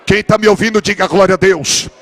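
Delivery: loud, driving sermon; full-force preaching. Acted. The pastor preaches to the congregation, loud and fervent. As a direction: preach it to the whole church.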